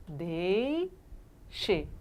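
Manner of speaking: "Deixe" soft, in two parts, dei, pause, xe; syllable split. Shown as a demonstration. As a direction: break "Deixe" slowly after the stretched dei. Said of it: "É assim que eu separo".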